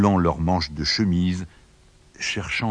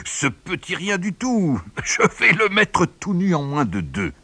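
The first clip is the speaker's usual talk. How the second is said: much higher, cocky gloating voice; boastful wind; 11 semitones higher than usual. A narrator's reading. The wind sizing up the man, much higher and gloating. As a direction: gloat over the little man.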